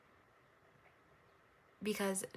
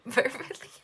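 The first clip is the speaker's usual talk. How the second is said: verbally said higher and louder through a laugh; 5 semitones higher, 10 dB louder than usual. Real, not acted. Reader retries the word verbally, laughing. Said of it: "I'm laughing at myself".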